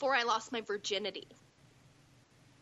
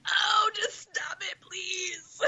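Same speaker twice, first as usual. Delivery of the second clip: higher, slow, hammed-up sobbing plea; mock crying plea; 6 semitones higher, much slower than usual. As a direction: beg him to stop through fake tears. Mock begging him to stop.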